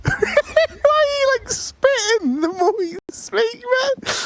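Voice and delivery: high, squealing through laughter; slow, breaking up with laughter; laughing. Real, not acted. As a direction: struggle to get the words out laughing. Cracking up at co-caster's accent.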